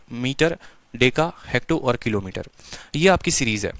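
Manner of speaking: ordinary delivery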